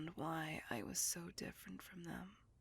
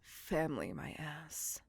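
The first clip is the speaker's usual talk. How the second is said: Family spoken louder and voiced, falling with scorn; bitter scoff; 2 semitones lower, 4 dB louder than usual. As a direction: spit Family out in contempt. Scorns her maker's "family.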